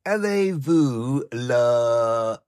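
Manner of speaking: high, slow put-on French accent; stagey French accent, drawn out; mock French. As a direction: ham up a French waiter address. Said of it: "I apparently embarrassed myself quite often over there".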